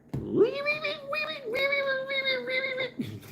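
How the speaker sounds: long, shrill squeak far up at the top of the range; boss's angry squeal. Retold or imitated. Mocking his boss when angry, an extremely high squeal. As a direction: mimic a furious boss whose voice cracks into falsetto. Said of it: "when he gets mad, he gets higher".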